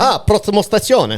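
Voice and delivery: high, hammed-up Italian announcer; mock Italian. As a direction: sing it like an Italian train call.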